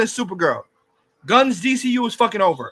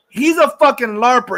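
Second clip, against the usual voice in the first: ordinary talk, nothing marked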